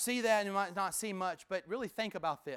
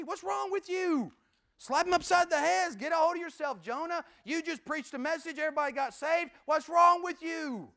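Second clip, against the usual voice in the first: higher, loud, incredulous rebuke; exasperated scolding; 9 semitones higher, 4 dB louder than usual. Acted. Preacher ranting at Jonah, exasperated and loud. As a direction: slap some sense into him.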